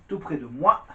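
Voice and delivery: ordinary talk, nothing marked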